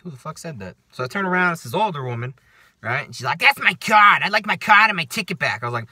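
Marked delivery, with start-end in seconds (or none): 3.4-5.6 loud, raised mock New Yorker; New Yorker accent. Older woman demanding her card back, mock New York accent. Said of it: "like, New Yorker accent".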